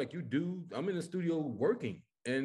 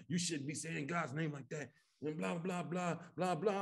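softly rattled-off mock reprimand; dismissively retold scolding; 7 dB quieter than usual. Lady scolds comic over God's name, retold dismissively. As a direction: toss off her complaint, trailing into blahs.

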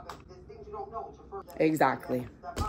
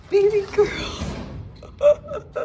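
high squealing with a laugh; excited squeal. Real, not acted. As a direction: squeal and giggle with joy. Opening packages.

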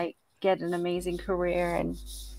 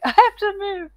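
mock urgent; 11 semitones higher, 8 dB louder than usual. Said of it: "I was like".

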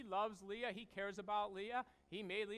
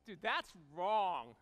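drawn-out disapproval; 5 semitones lower, much slower than usual. Real, not acted. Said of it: "comic disapproval".

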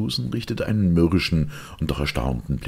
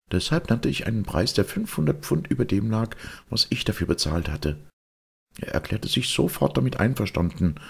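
a hint of a higher-voiced lady recounting events; lightly voiced lady client; 8 semitones higher than usual. Client tells Holmes about the strange buyer, lightly voiced lady.

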